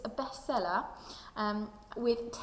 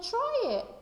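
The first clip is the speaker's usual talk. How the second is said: encouraging dad; 12 semitones higher, 5 dB louder than usual. Acted. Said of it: "but Dad said".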